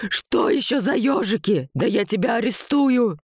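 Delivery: high, squealing outrage; ultrasonic wail. A narrator's reading. Vova threatens Anton with arrest, high outraged shriek. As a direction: screech it in a strained high voice. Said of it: "Вопль Вовы перешёл на ультразвук".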